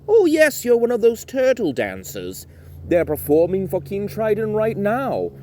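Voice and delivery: high, loud, excited guard; guard's voice. Acted. Mermaid guard asking Timothy for a jig, high and excited. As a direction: perk up high like a bored guard.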